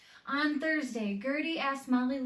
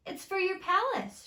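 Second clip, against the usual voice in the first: 1 happy offering; 10 semitones higher, 5 dB louder than usual. Acted. Gertie gives gift.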